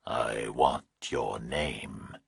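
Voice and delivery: slow, low, menacing whisper; distorted nightmare voice. A narrator's reading. Kidnapper on the phone to Burke, slow eerie whisper. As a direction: breathe it like a monster on the line. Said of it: "The nightmare voice asked".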